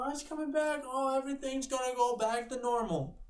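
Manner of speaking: high naive mocking tone. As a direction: mimic naive hopeful people. Mocking what people think.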